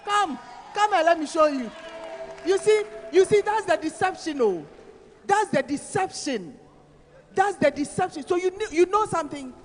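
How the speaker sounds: loud, high preacher's cry; preaching at full force. Acted. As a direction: rouse the congregation with a loud, high call.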